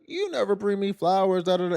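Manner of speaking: high, lightly mocking nag; a hint of a nagging girlfriend. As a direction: parody the girlfriend's complaint. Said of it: "now all of a sudden".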